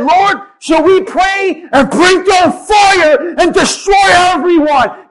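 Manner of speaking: shouting like a hot-tempered disciple; angry outburst. Retold or imitated. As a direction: call down fire in hot-headed rage. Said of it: "And John and James said".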